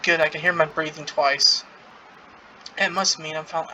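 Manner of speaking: ordinary delivery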